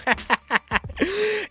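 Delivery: a sudden squeaky giggle; high giggle. Real, not acted. Laughing at a silly khaki iPod case, high squeaky giggle.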